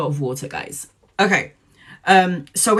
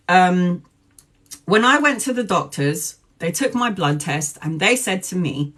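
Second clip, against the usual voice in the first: ordinary talk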